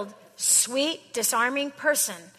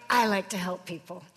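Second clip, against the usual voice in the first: lightly voiced do-gooder, lower; mocking the nice helper line; a hint of a sanctimonious helper; mock sweet quote; 4 semitones lower than usual. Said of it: "You just hide yours behind".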